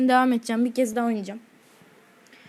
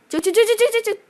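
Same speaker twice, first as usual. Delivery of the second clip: much higher, rapid excited chant; frantic urging; 10 semitones higher, 7 dB louder than usual. Real, not acted. Gamer frantically urging his character out. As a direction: hammer the word out frantically.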